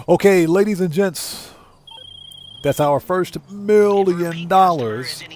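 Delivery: slow, high villain announcement; Doctor Evil voice. Retold or imitated. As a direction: savor it like a gloating supervillain. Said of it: "In my Doctor Evil voice".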